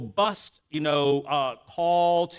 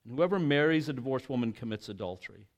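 measured, solemn reading of scripture; formal Bible reading voice; 2 semitones lower, 4 dB quieter than usual. Acted.

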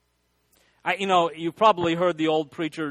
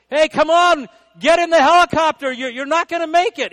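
much higher and louder, yelling down from above; shouting over the helicopter; 11 semitones higher, 10 dB louder than usual. Retold or imitated. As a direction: call down the ladder, he's not going to make it. Coast Guard calls from helicopter.

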